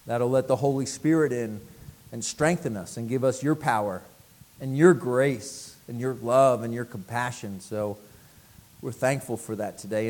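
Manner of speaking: low, soft prayer voice; closing prayer. Acted. Pastor leading congregation in prayer, low and soft. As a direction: pray it quietly and humbly.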